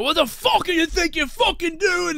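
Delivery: angry bartender screaming after him outside; imitated scream. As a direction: shriek it like the furious bartender chasing him. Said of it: "He's screaming".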